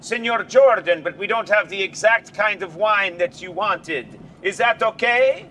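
mock-formal waiter voice, pitched high. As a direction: ham up an apologetic waiter to Senor Jordan. Conan plays waiter to Jordan.

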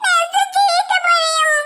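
put-on drawn-out whimper of a little girl; slow sobbing child. Maina sobs over her new book, slow and whimpering. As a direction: sob each word slowly like a hurt child.

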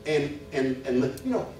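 high, loud, driving sermon delivery; fervent preaching. Acted. Preacher pressing the congregation about faith, fervent. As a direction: preach it hard, rising to a peak. Preaching at full force again.

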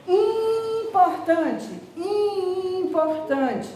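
importante said high and slow, nasal vowel stretched; teacher modelling the nasal vowel in importante; drawn-out nasal demo. Shown as a demonstration. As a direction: sound out importante slowly so the nose hums. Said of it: "olha só".

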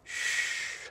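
soft unvoiced hush to calm someone; soothing shush. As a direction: shush them gently, barely a breath. Soothing a hurt spouse.

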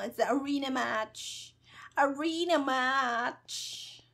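ordinary delivery

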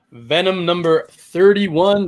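ordinary delivery

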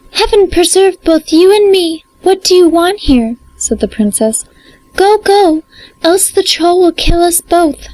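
a terrified princess begging him to go; cartoon princess. Youngest princess alarmed.

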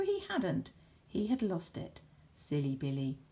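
plain reading voice

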